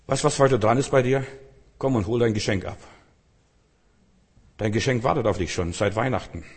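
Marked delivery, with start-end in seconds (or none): none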